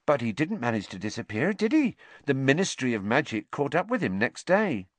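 mildly smug, lifting high on the question; some satisfaction. A narrator's reading. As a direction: make the point with mild satisfaction. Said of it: "said with some satisfaction".

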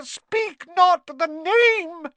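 loud, very high, drawn-out elf wail. A narrator's reading. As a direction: wail it slowly, at full squeak. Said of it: "Dobby clapped his hands ... and moaned".

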